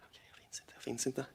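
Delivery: breathy whisper, chanting to calm down; a hushed self-soothing chant; whispered mantra. Real, not acted. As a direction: whisper it to yourself to stay calm. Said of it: "Gå inom dig".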